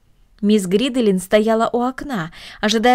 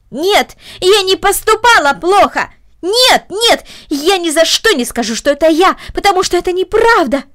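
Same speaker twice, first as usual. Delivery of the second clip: shouting denial; 8 semitones higher, 6 dB louder than usual. Acted. Girl yells denial at aunt.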